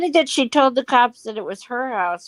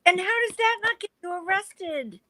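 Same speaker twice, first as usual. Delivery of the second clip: shocked; 10 semitones higher, 5 dB quieter, faster than usual. Real, not acted. Shocked listener asks.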